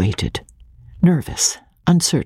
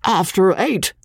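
wheezed; 7 semitones higher than usual. A narrator's reading. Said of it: "wheezed Cotton Whiskers".

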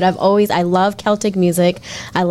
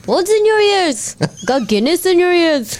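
put-on Irish accent; 8 semitones higher than usual. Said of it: "teaching Alexis how to do an Irish accent".

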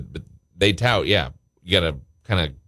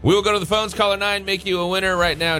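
upbeat DJ contest pitch; radio contest promo; 5 semitones higher than usual. Acted. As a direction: sell the call-in giveaway.

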